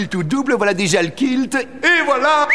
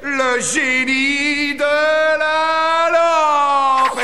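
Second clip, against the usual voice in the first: announcer voice; 4 semitones higher, much slower than usual. Acted. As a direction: stretch it like a show intro. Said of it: "Le voici en direct de la lampe".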